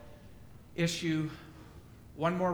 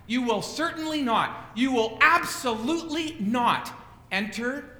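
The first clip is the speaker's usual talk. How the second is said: louder, higher, leaning on "certainly" and "absolutely"; emphatic warning; 5 semitones higher, 5 dB louder than usual. Acted. Preacher presses the emphatic Greek on his hearers, louder and higher. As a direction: drive home "absolutely" like an oath. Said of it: "the Greek here is emphatic".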